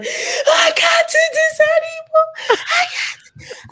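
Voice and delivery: very high, drawn-out wail; hammed-up breakdown, wailing slowly; loud mock crying. Exhausted traveler breaking down at the airport, loudly crying. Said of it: "it wasn't that I was crying quietly".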